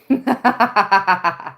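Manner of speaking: loud laughing burst; real bright laughter. Real, not acted. Laughing at own impression.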